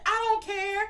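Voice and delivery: very high, loud retort; high-pitched outburst. Retold or imitated. Worker cutting off his boss, shrill-high mock outburst. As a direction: fire it back way up high, acted out. Acted quarrel, cutting in on him.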